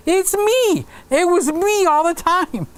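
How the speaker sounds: high, far-off mock discovery of his own guilt; mock realization. Joking to Bob that he is what's wrong, in mock surprise. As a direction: squeak it out like you just caught yourself.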